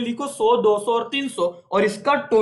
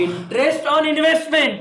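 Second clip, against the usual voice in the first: raised, drawn-out quiz prompt; drilling call-out; 7 semitones higher, 4 dB louder, much slower than usual. Shown as a demonstration. Teacher drilling the class on interest entries, calling out. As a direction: call it out slowly, high and loud.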